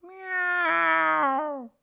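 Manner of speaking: long high cat meow, falling at the end; high, drawn-out cat yowl; big meow. Acted. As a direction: meow like an unhappy cat in a basket. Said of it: "She meowed a big meow".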